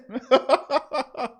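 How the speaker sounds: high burst of laughter; amused laugh. Real, not acted. Host recalling a caller's venting, amused laugh. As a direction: laugh at your own retelling.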